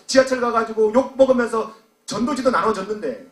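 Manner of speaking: high, loud, driving preacher's delivery; impassioned preaching. Real, not acted. Preacher pressing the congregation about faded first love, impassioned. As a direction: preach it out at full force.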